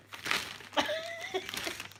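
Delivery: high, soft giggle. Real, not acted. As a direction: giggle lightly to yourself. Amused at nearly leaking address.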